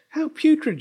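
very high wail of disgust; high-pitched storybook dismay; dismayed princess. A narrator's reading. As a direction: lament it as a spoiled princess. Princess dismayed by her nose.

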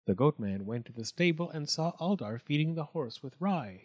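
ordinary delivery

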